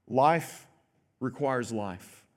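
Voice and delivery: life requires life, slow and sinking; slow solemn. Shown as a demonstration. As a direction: let it land slowly and gravely. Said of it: "This goes back to the Noahic covenant".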